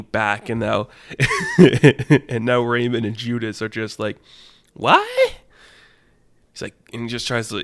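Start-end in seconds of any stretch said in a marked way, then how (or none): 4.7-5.0 high squeaked "what?"; loud, shooting-up squeal of disbelief; shocked minion. Acted. Minions react to the punch.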